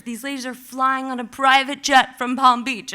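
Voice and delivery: ordinary talk, nothing marked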